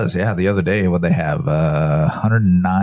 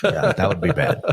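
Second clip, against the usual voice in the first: higher, chuckling agreement; laughing; 11 semitones higher than usual. Real, not acted. Friend laughs at the story of falling through the ice.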